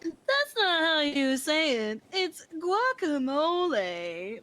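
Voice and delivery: slow, deliberate correcting voice; slow and prissy; mock correction. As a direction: drag out the correction. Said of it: "purposely saying guacamole wrong".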